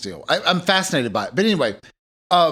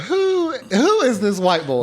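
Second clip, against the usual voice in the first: high, drawn-out incredulous question; 7 semitones higher, slower than usual. Acted. Imagining a lady overhearing the phrase, high drawn-out disbelief. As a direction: stretch it out, pitched up in disbelief.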